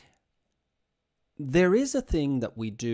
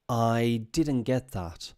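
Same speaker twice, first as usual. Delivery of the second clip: slow, drawn-out "I didn't get that"; slow model phrase; 3 dB louder, much slower than usual. Shown as a demonstration. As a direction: say it slowly for language learners. Teacher models a phrase for students.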